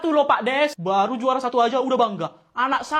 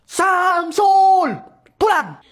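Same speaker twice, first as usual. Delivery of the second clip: loud commanding yell; yelling at son; 5 semitones higher, 5 dB louder, much slower than usual. Acted. Parent yelling at the boy to come home, loud. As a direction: shout him home at full voice.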